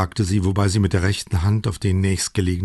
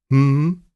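high, louder hum of agreement; Paola, assenting hum; 6 semitones higher, 5 dB louder than usual. A narrator's reading. Wife agrees to the Cabernet, approving hum. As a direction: hum your approval, pitched up. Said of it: "machte Paola zustimmend".

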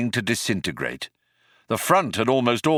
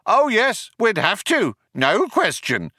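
high calling agreement, dropping at the end; called back; 11 semitones higher than usual. A narrator's reading. Second cop backs up his partner, called back. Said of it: "the other one called back".

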